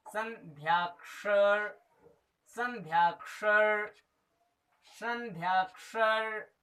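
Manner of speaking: संध्याक्षर said slowly, syllable by syllable; slow recitation. Shown as a demonstration. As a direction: spell out संध्याक्षर slowly for students. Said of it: "क्या कहा जाता है?". (संध्याक्षर धीरे-धीरे स्पष्ट बोलना)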